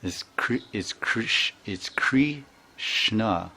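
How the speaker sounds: Krishna drawn out very slowly, Kri then shna; Indian pronunciation. Retold or imitated. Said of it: "they all say".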